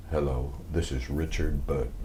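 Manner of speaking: a lightly voiced Richard Burton, low and soft; Richard Burton impression. Star phoning the answering service, lightly mimicked low Burton voice. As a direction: drop into a hint of Burton's theatrical baritone. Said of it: "and it was".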